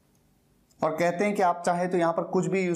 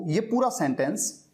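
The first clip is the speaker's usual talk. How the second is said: ordinary talk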